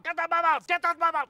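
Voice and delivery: usual speaking voice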